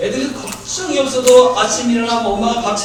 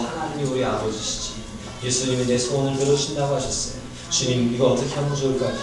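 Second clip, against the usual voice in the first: soft, lower prayer to a father; quiet trusting prayer; 6 semitones lower, 6 dB quieter than usual. Pretend. Pastor models a heartfelt prayer for the congregation, soft and trusting. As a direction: talk to God gently, like a child. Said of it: "마음으로".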